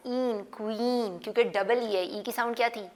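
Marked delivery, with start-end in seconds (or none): 0.1-1.2 ईन, क्वीन with the ee drawn out; slow, stretched ee in ईन and क्वीन; long ee. Shown as a demonstration. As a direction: drag the ई in क्वीन slowly. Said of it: "क्वीन में ई की आवाज़ ज़्यादा आ रही है".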